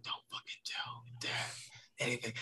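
whispered through the teeth, barely any voice; hushed warning. Retold or imitated. Little sister warns brother not to tell Dad, whispered. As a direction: hiss it as a secret threat.

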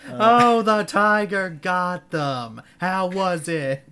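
high, loud and slow mock lament; hammed-up grief. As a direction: drag it out like a melodrama. Parodying a tearful reveal.

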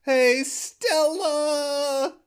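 very slow, high, drawn-out call; Stella yell. Retold or imitated. Quoting the famous play's line, slow mock yell. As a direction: stretch the name like a desperate husband. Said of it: "the reference to the play".

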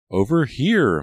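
high, loud cry. A narrator's reading. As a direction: shout to be found. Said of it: "Maya cried".

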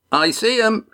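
a high call across the boat; high excited yell from the bow. A narrator's reading. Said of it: "he yelled".

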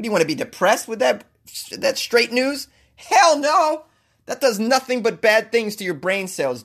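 high-pitched, hammed-up outrage; mock rant. Mock rant against mainstream news.

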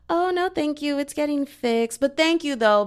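high, overly sweet polite voice; polite brush-off. Retold or imitated. Woman politely turning down a stranger, high and sweet. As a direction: sing it out sweetly to get rid of him. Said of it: "I was like".

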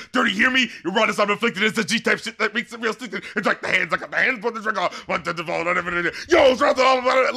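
mock rap verses building to a shout; outdoing in power. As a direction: trade bars as if topping each other, ending loud. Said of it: "trying to outdo each other just in power".

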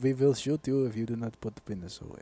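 low mock Russian accent. Russian guys telling him to put it away, in a Russian accent. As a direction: order him about as a deadpan Russian. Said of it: "Russian guys telling another Russian guy".

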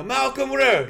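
high, loud name call; projected gym-class roll call; coach's roll call. Retold or imitated. Coach calling tryout roll.

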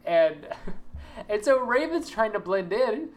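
ordinary delivery

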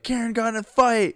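high, loud urgent announcement; excited yell. Retold or imitated. Friend running into the room to report a fight, yelling. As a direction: imitate someone bursting in with news.

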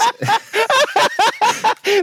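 squealing high laugh. Real, not acted. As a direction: burst into laughter. Laughing mid-sentence.

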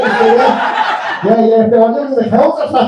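usual speaking voice

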